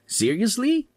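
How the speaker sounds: sharp high disbelieving question; incredulous. Acted. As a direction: toss it up high in mock disbelief. Husband reacts to wife's news.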